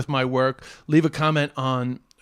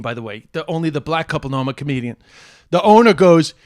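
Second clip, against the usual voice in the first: ordinary talk, nothing marked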